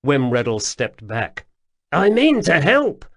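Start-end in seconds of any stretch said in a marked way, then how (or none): 1.9-3.0 high, loud jittery stranger; pleading, flustered little man; nervous odd man voice. A narrator's reading.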